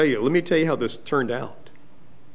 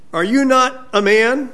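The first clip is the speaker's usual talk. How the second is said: higher-pitched mock question, lifting at the end; sarcastic challenge; 7 semitones higher than usual. David taunts Abner over his manhood, sarcastic. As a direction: lightly taunt him with a rising question. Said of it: "very sarcastic".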